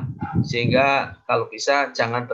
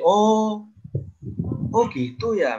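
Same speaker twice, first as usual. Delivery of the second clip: listener's surprised oh; 7 semitones higher, slower than usual. Retold or imitated. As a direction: act the listener who just learned something. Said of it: "kita yang mendengar mungkin jadi". (pendengar yang kaget dan kagum, "oh gitu ya")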